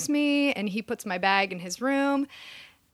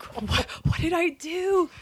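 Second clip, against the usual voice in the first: high, slow lament; retold dismay; 8 semitones higher, slower than usual. Newcomer lying awake in the dark, dismayed. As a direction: wail it slowly to yourself, mock despair.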